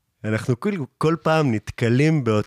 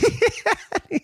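high, real giggle; laugh. Real, not acted. After mimicking the hipster, high real giggle. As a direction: crack up at your own imitation.

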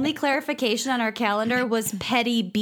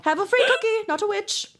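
high, sing-song crone voice; high witch voice; 10 semitones higher than usual. Retold or imitated. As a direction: coax the kids like a fairy-tale witch. Imitating witch handing cookies.

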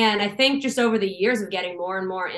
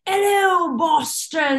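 high-pitched stage yell to a crowd; mock rock-star scream, drawn out; 8 semitones higher, 3 dB louder, much slower than usual. Rock singer greeting the concert crowd, imitated scream. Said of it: "he screamed".

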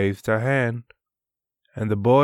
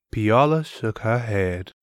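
usual speaking voice

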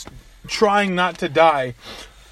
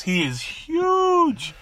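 He is huge, slow and high with huge stretched; drawn-out emphasis; 11 semitones higher, much slower than usual. Retold or imitated. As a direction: draw out He is huge. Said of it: "stressing how big".

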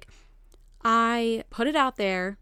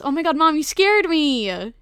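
sudden startled cry, higher and louder; startled outburst; 7 semitones higher, 6 dB louder than usual. Real, not acted. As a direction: jump out of your skin mid-sentence. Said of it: "she actually scared the shit out of me".

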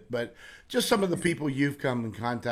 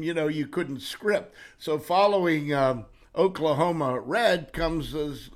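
ordinary talk

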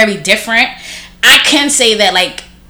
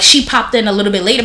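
ordinary talk, nothing marked

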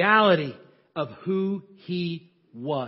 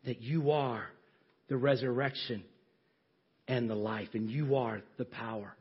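prayer; 3 semitones lower than usual. Acted. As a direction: declare faith to God, fading into a hush. Prayerful declaration to God.

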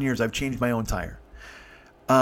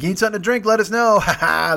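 high, loud mock offer, then laughing; playful heckle capped with a ha-ha; teasing crowd; 6 semitones higher, 5 dB louder than usual. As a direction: imitate jeering kids. Crowd teases and laughs, acted.

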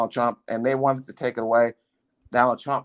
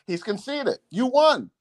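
excited staffer; 10 semitones higher than usual. Retold or imitated. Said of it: "another staffer ran into the room and said".

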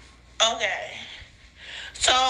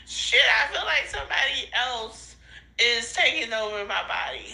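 usual speaking voice

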